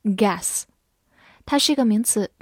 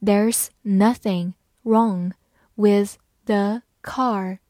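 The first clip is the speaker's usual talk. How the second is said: slow, word by word; slow and clear, each word apart; slow reading; much slower than usual. Shown as a demonstration. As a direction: read it out slowly for learners. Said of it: "好，慢慢来读。".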